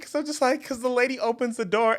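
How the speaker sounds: normal talking voice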